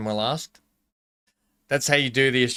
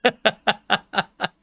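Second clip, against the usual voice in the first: laugh; 8 semitones higher than usual. Real, not acted. Laughing at own Aussie impression.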